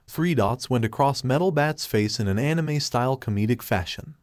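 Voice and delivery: usual speaking voice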